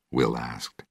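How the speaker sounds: ordinary delivery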